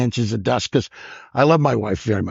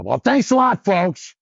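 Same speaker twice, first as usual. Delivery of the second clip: high, put-on showman voice; hammed-up comic thanking a dead room; mock stage sign-off; 10 semitones higher than usual. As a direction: thank the crowd in a pitched-up stage voice. Comic retells his stage sign-off.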